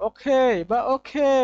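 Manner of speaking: ওকে বা ওকে drawn out slowly, every sound clear; slow drilled okay. Shown as a demonstration. Teacher models the correct pronunciation for students: slow, careful ওকে. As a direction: stretch each ওকে slowly. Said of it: "বলবো ওকে".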